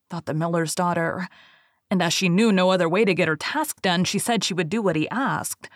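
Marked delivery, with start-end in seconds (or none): none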